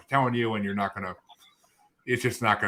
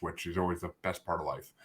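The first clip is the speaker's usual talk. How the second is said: sarcastic; 2 semitones lower, 8 dB quieter than usual. Real, not acted. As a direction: say it with deadpan irony. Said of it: "saying that sarcastically".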